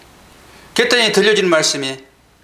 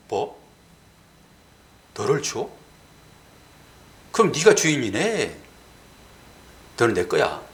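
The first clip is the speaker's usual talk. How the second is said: God's voice; 8 semitones lower than usual. Retold or imitated. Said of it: "이런 소리가 딱 들리더라고요".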